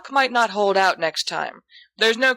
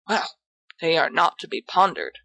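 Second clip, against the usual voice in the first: plain reading voice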